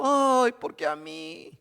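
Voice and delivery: drawn-out mock lament over a small trial; mock whining. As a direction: whimper it like a spoiled believer. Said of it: "nosotros andamos llorando".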